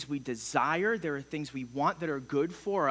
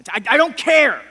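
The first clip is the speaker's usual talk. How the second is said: loud, higher defensive retort; loud and higher, brushing it off; angry denial; 7 semitones higher, 15 dB louder than usual. Retold or imitated. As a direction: bark it out like you mean it. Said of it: "And I'm like".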